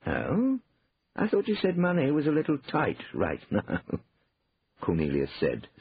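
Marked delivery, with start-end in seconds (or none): 0.0-3.8 higher, amused query; feigned innocence with a suppressed laugh; stifled chuckle. A narrator's reading. Brother catches out the excuse, stifling a chuckle. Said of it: "stifling a chuckle".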